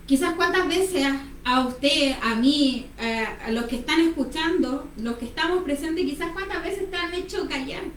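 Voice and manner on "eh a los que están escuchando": normal talking voice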